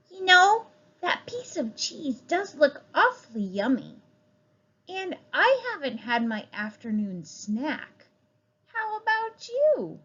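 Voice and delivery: put-on high, bright-eyed child voice; eager child hinting at cheese; little child. Child character speaks.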